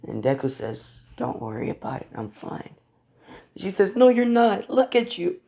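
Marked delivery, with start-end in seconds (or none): 1.2-2.8 soft, fading almost to breath; quiet reassurance. Acted. Injured papa to worried daughter, quiet reassurance. Hurt hero reassures crying girl. 4.0-5.4 put-on high-pitched, upset little girl; distressed child. Crying girl to her injured papa, distressed. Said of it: "she's crying on him".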